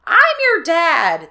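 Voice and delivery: high, loud, slow bellow. Acted. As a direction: roar it to claim your children.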